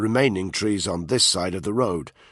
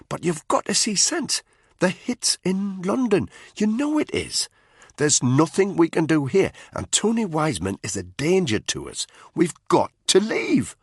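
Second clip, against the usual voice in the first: higher voice, pleading with his mate; worried soldier urging his friend; Spud, urgent; 9 semitones higher than usual. A narrator's reading. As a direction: push him to see sense, pressing.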